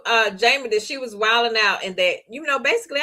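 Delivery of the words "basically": ordinary delivery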